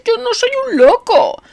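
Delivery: very high, pleading, stress on yo; desperate plea. A narrator's reading. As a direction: insist desperately on yo. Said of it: "suplicó". (súplica desesperada)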